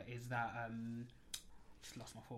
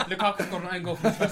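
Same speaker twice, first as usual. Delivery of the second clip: laughing; 6 semitones higher, 9 dB louder than usual. Real, not acted. Laughing over own goal story.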